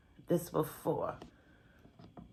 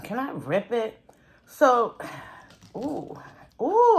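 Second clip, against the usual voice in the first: ordinary talk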